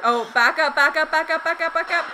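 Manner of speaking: loud urgent yell. Real, not acted. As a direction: shout it out urgently. Yelling at the screen.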